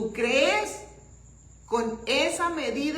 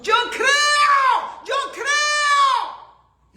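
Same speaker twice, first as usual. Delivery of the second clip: loud, much higher repeated cry; shout; 12 semitones higher, 8 dB louder, much slower than usual. Retold or imitated. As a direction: shout like the desperate father. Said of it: "Así como este hombre gritó".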